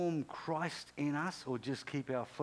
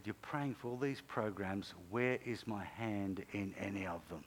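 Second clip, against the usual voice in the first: lower, softer voice of God; God's quiet reproof; 5 semitones lower, 5 dB quieter than usual. Retold or imitated. God questioning Johnny about his programs, quiet low reproof. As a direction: lower and hush it into a grave question.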